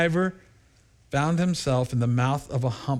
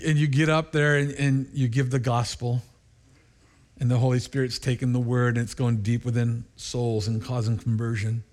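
ordinary talk, nothing marked

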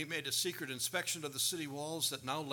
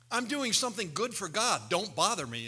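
firm refusal; 5 semitones higher, 7 dB louder than usual. Acted. Nehemiah rebuffing his critics.

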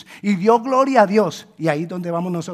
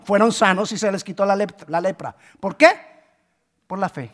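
ordinary delivery

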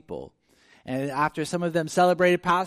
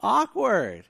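laughing indignation; 7 semitones higher, 5 dB louder, slower than usual. Retold or imitated. Mock reaction to nosy stranger.

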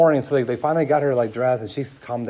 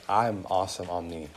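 smug; 5 semitones lower, 5 dB quieter than usual. Retold or imitated. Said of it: "blatantly say".

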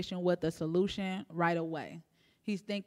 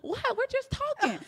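mock bafflement. Sisters' baffled reply to alarmed coworkers.

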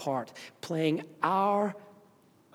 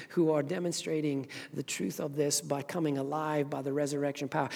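ordinary talk, nothing marked

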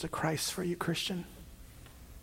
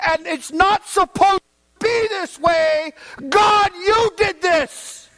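accusing God; 11 semitones higher, 7 dB louder than usual. Acted. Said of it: "what the people have done is they say".

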